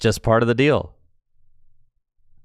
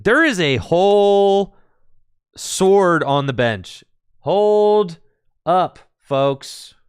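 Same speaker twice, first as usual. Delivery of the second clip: higher, louder, slow drawn-out words; hammed-up announcement; 9 semitones higher, 5 dB louder, slower than usual. Streamer hyping a sword on the bench, hammed-up announcement. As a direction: stretch each word out big and high.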